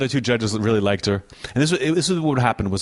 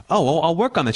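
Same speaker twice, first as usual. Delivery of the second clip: lightly voiced eager contestant, a bit higher; bright, trying to be nice; mock polite girl; 6 semitones higher than usual. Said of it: "And she goes".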